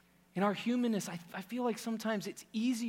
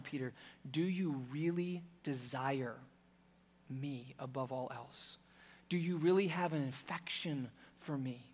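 Jesus, tender; 4 semitones lower, 6 dB quieter than usual. Acted. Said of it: "as if Jesus is saying".

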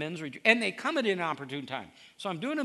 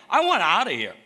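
pleading outcry; 3 semitones higher, 12 dB louder than usual. Acted. Joseph's plea voiced in sermon.

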